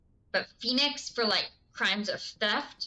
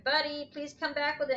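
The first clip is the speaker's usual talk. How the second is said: pleading with dog; 7 semitones higher than usual. Retold or imitated.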